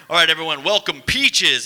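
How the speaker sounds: mock announcer, big and loud; club announcer. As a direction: belt out the name like a club MC. Strip club announcer introduces dancer.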